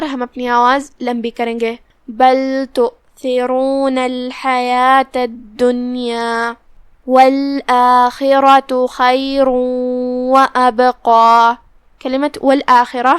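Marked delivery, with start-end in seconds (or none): 2.1-11.5 very slow tajweed chant, drawn-out vowels; slow melodic Quran recitation; Quranic recitation. Shown as a demonstration. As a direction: recite the verse slowly and melodically. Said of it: "teacher recites the verse".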